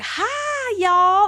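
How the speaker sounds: quick, high Texan hi y'all; Texan drawl-free quick hi y'all; Texan greeting. Retold or imitated. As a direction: chirp a fast Texan hello. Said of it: "Texans talk fast".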